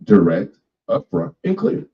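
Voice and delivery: deliberate, spaced-out emphasis; direct and clear. Real, not acted. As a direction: say each word plainly and pointedly. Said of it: "I want y'all to hear this".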